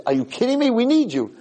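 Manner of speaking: high, urgent disbelief; exclaimed retold plea; incredulous appeal. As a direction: plead with the kid, pitched right up. Mayor pleads with the little kid.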